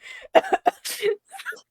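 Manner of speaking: high wheezing laughter; shrieking laugh. Real, not acted. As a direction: lose it in a shrill laugh. Cracking up over curd.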